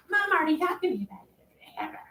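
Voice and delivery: put-on whiny kid excuse. Kid's excuse to dad.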